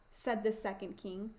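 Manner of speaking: usual speaking voice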